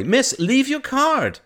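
high, loud, drawn-out call; slow, high-pitched call from the doorway. Acted. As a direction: shout after a departing customer. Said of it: "cried Rupert from the doorway".

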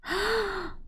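high sharp gasp of fake shock; mock gasp. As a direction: gasp as if scandalized. Shocked by found fact.

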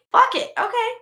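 high exasperated snap; fed up, giving in. Retold or imitated. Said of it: "and I'm like".

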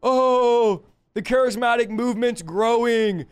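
preacher mocking worried cessationists. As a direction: mimic a rival fretting over the news. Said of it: "all the cessationists are like".

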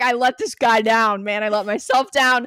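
loud, rising mock lament with a laugh; retold self-reproach. Recalling the broken promise, in loud hammed-up self-blame. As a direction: cry out each failure, half laughing.

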